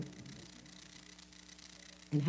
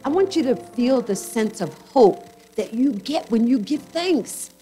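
raised, impassioned preaching, louder in bursts; fervent preaching; 4 semitones higher, 4 dB louder than usual. Acted. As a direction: preach it with conviction to the congregation. Pastor preaching with rising fervour.

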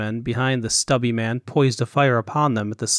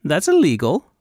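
puzzled, higher-pitched reply; perplexed; 5 semitones higher, 4 dB louder than usual. A narrator's reading. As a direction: answer in mild bafflement, pitch lifted. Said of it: "responded, perplexed".

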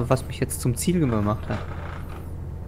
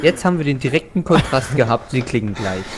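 ordinary talk

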